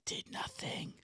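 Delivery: breathy whisper of disgust; a whispered, disgusted aside. Real, not acted. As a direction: whisper it like a bitter verdict. Mocking the loss.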